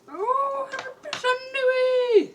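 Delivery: very high, slow, silly squeal; hammed-up high greeting; mock cutesy singsong hello; funny face voice. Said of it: "that's not a serious moment".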